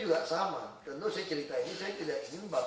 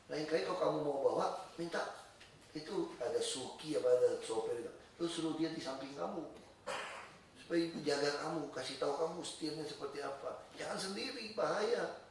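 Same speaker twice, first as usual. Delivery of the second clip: gentle fatherly advice; 2 semitones lower, 7 dB quieter than usual. Retold or imitated. Father advising daughter after the crash, gentle and soft. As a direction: advise softly without any scolding.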